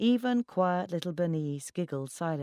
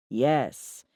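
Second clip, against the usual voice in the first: louder, firm yes; said loudly; 5 dB louder than usual. A narrator's reading. Ex answering whether he can get away, said loudly. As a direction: raise the volume on one firm word. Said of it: "he said loudly".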